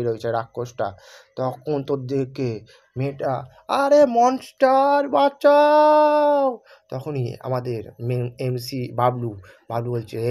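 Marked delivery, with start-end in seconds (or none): 3.8-6.4 long, very high, loud drawn-out scream for help; terrified scream. Acted. Girl screaming for help as the monster appears, slow loud high shriek.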